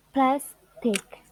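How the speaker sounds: Plastic split in two, PLAS stronger; PLAS, then a lighter "tic" in Plastic; stress on first syllable. Shown as a demonstration. Teacher repeating the example of syllable stress, PLAS stressed. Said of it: "the first syllable is stressed".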